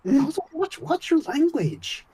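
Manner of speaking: high-pitched, drawn-out telling-off; mock scolding. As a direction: scold them playfully, slowing on the reprimand. Mock scolding over a mild swear.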